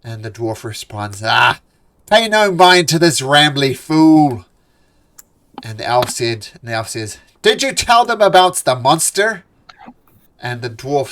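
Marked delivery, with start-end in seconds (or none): 1.2-4.5 loud, slow, scornful dwarf; dismissive dwarf. Acted. Dwarf foreman brushes off the newcomer. 7.4-9.4 loud, high, pressing question; pointed elf challenge. Acted. Half-elf demands whether the dwarf told them.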